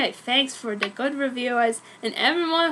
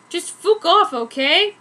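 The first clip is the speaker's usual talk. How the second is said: louder, higher snap; angry outburst; 5 semitones higher, 6 dB louder, slower than usual. Acted. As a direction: tell him off hard.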